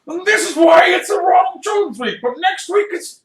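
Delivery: high-pitched full shout, hammed-up frenzy; wild, loud comic raving; manic rant. Acting a manic sideline rant.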